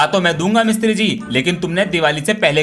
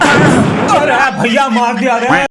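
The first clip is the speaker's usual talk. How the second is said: loud, high, drawn-out cry of pain; pain yell; 7 semitones higher, 4 dB louder, slower than usual. Acted. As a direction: howl like the cracker hit you. Firecracker hurt him. (दर्द से लंबी चीख)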